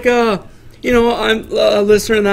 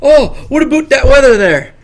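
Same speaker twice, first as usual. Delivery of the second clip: mock Canadian accent; 3 semitones higher than usual. Denying he talks like this to people, mock Canadian. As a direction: exaggerate a Canadian greeting, "about" rhyming with "boot". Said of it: "do you think I seriously go up to people like".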